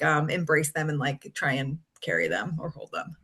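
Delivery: normal talking voice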